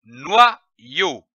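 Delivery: "noyau" slowly split in two with a pause; "noyau" in detached reading-lesson syllables. Shown as a demonstration. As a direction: sound out "noyau" as noi, then yau. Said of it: "L'association de ces deux syllabes donne".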